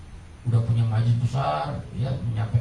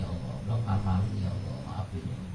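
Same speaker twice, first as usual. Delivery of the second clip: softer, lower, mock-tearful plea to God; a hint of weeping repentance, much softer; tearful prayer; 6 semitones lower, 12 dB quieter than usual. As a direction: murmur a regretful prayer as if near tears. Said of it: "Cari ilmu sampai menangis".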